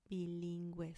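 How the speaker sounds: Bilingüe said with gwe; Bilingüe, the u sounded as w; pronounced ü. Shown as a demonstration. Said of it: "So you do pronounce the U here".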